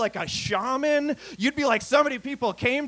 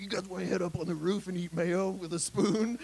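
softer, breezy ad voiceover parody; mock ad voice; 3 semitones lower, 6 dB quieter than usual. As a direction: sell mayo to hipsters, lightly mocking. Mocking a mayo ad.

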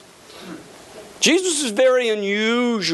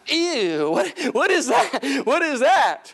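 disgusted; 4 semitones higher, slower than usual. Pretend. Disgust at spitting healing.

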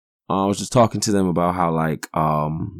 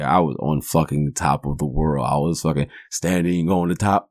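ordinary delivery

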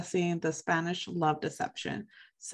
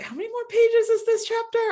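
much higher, strained mock whine; exasperated groan; 4 dB louder than usual. Host imitating her own exasperation at long chapters, high and whiny. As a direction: whine like a reader who can't face more pages. Exasperated reader quoting herself.

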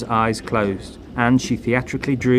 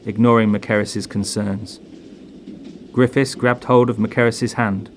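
ordinary delivery

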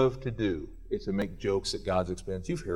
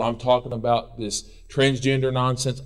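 normal talking voice

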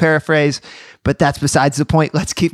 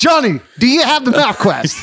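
dad calling out; 6 semitones higher than usual. Retold or imitated. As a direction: shout the question like a busy dad. Dad calling out about directions.

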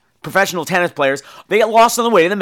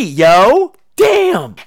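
excited exclamation; 3 semitones higher, 6 dB louder than usual. Real, not acted. Excited about free festival streaming.

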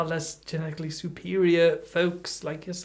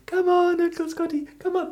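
high, sing-song coaxing; coaxing a toddler; 12 semitones higher than usual. Retold or imitated. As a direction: call him over like a small child. Beckoning a three-year-old from limousine.

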